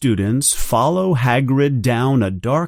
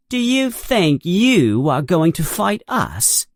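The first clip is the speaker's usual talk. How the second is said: higher, sneering schoolboy challenge; mean Malfoy taunt; 6 semitones higher than usual. Acted. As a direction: taunt them as a cocky bully. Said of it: "asks Malfoy with a mean look".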